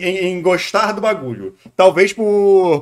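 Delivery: usual speaking voice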